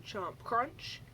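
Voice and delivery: ordinary delivery, nothing marked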